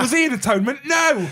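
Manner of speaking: high-pitched comic indignation; mock outrage. Co-hosts bickering over who is a star, mock indignant.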